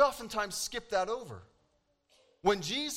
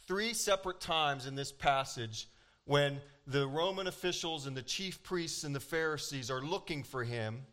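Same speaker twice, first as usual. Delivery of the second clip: ordinary delivery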